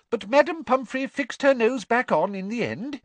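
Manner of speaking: high, brisk schoolmistress; bossy teacher, pitched high. A narrator's reading. Voices Professor Sprout.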